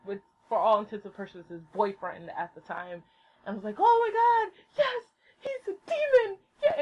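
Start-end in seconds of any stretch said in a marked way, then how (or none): 3.8-6.8 high, giddy fan squeal; reenacting a thrilled outburst; overjoyed squeal. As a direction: gush in delight, pitched way up. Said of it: "I was just really fucking happy".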